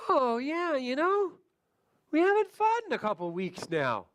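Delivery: suddenly remember the good news; client Mary. Acted. Client Mary recalling improvement.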